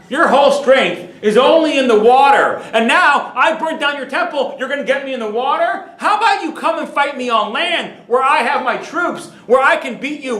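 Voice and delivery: loud, high defiant jeering at the sky; mocking taunt. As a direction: taunt heaven like an arrogant emperor. Titus taunts God during storm.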